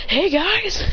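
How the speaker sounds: high, friendly mock call. Kakashi runs up to his students, calling out. Character calls out while running.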